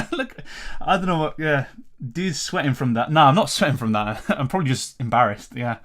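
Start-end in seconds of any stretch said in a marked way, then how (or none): none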